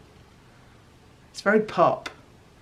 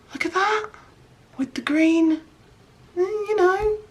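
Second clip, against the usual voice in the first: much higher, giddy delight; thrilled squeal; 13 semitones higher than usual. Real, not acted. Vlogger gushing over green crayon swirls, high giddy excitement. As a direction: gush over every colour.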